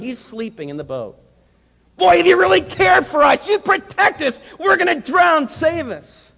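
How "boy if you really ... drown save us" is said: high, loud terrified yelling; desperate yell. Acted. Disciples shaking Jesus awake, terrified yell. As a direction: scream over the storm for rescue.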